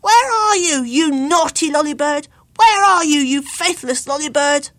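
loud, high calls into the night; plaintive loud calling. A narrator's reading. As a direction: cry out for the lost bird. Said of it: "All night he called and called".

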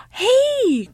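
put-on very high, loud child's shout; excited cry. A narrator's reading. Ugly child calls to the old woman, excited cry. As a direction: cry out in sudden discovery. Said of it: "cried the ugly child".

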